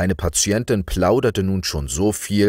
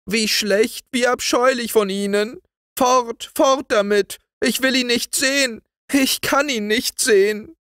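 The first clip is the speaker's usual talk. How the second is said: put-on very high, distraught child's wail; shrieky-high little girl lamenting; wailing outcry. A narrator's reading. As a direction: wail in horror and send him away. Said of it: "auf das Jammergeschrei".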